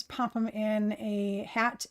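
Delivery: ordinary talk, nothing marked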